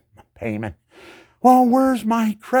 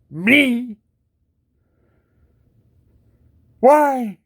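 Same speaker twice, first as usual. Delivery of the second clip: higher, louder indignant question; outraged; 11 semitones higher, 7 dB louder than usual. Real, not acted. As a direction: demand why, incredulous. Indignant outcry.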